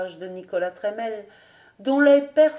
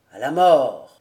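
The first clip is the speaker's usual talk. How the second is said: loud, low, resolute exclamation; exalted cry; 6 semitones lower, 8 dB louder than usual. A narrator's reading. Old nobleman illuminated by sublime enthusiasm, crying out low and firm. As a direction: proclaim it with solemn fervour. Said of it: "s'écria le vieillard".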